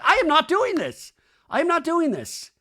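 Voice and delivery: high, loud imitated outburst; mock-furious high protest; indignant refusal. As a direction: act out someone storming off. Retold Michelle storming up from table.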